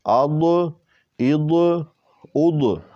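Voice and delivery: "اد" thick and full, with a heavy ض; heavy dad. Shown as a demonstration. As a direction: drill "اد", making the ض heavy. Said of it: "اس کو موٹا کریں گے".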